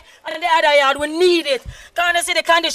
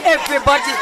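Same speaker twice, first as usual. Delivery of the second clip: ordinary delivery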